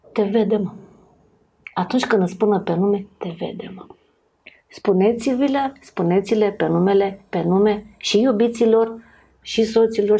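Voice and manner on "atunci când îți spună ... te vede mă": ordinary talk, nothing marked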